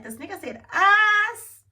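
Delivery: high, slow and loud, in shocked disbelief; stunned disbelief. Retold or imitated. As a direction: play the shock that he said "us". Shocked reaction to "us.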